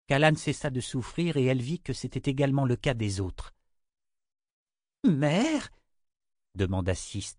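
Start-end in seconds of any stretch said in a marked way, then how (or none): 5.0-5.7 high, bewildered rising question; disoriented. A narrator's reading. Six hearing her mother behind her, disoriented. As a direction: ask in stunned disbelief, high-pitched. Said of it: "totalement désorienté".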